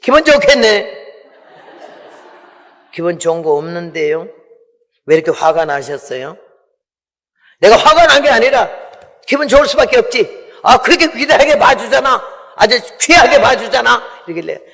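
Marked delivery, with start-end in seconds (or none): 0.0-1.0 higher, sneering sarcastic remark; husband's sour sarcastic dig; sarcastic jab. Retold or imitated. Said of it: "남편이 나보고 들어오면서 이래요". 7.6-14.0 higher, louder sulky grumble; a touch of mock huffy husband; husband snapping back at his praised wife; irritated husband. Husband's irritated retort retold.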